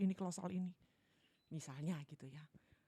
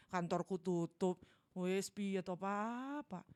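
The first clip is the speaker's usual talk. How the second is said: friendly phone call; 2 semitones higher, 7 dB louder than usual. Acted. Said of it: "Intonasi suara itu juga strategi". (menelepon klien dengan ramah lalu memohon pelan)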